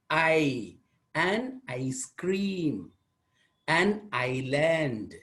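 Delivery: "ice cream" and "island" said slowly, long I; slow model words. Shown as a demonstration. Teacher modelling long I words for students, slow. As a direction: draw each word out slowly. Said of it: "I itself".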